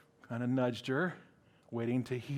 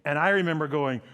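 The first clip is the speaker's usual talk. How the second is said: normal talking voice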